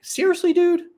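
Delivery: high-pitched, incredulous mock rebuke of his son; exasperated disbelief. Dad retells texting his son.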